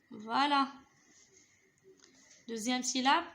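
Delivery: ordinary talk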